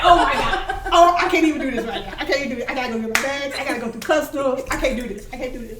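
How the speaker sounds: loud, fast flustered outburst; too much to handle right now; overwhelmed. Retold or imitated. Just landed and reading the message, loud flustered overwhelmed outburst.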